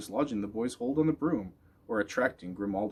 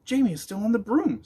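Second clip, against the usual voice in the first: sighing dog; 11 semitones higher, 6 dB louder than usual. A narrator's reading. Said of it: "sighed Spook".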